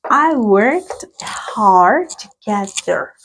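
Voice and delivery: slow, careful "hard to get there"; slow classroom demo reading; slow model sentence. Shown as a demonstration. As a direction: drag the sentence out for learners. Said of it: "teacher models the sentence".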